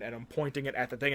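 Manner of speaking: ordinary delivery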